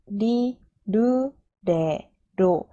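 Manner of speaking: very slow, evenly spaced kana drill; chant-like slow row recital; syllable-by-syllable recitation. Shown as a demonstration. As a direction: model each syllable for learners, held and separated. Teacher models the ra row.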